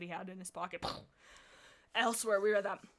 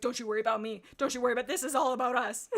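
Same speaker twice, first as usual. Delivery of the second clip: sassy retort; 3 semitones higher, 7 dB louder, faster than usual. Acted. Cat's breezy retort.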